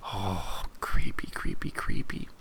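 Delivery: hushed, spooky whisper; creepy whisper. Real, not acted. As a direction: whisper it like a ghost story. Reacts to the creepy villain.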